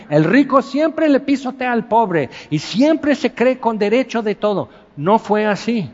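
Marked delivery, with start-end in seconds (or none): 0.1-4.7 high, mocking voice of a self-righteous thought; mock prejudice. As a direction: parody your own snap judgment. Voicing his own prejudiced thought. (burla de su propio prejuicio, en tono agudo)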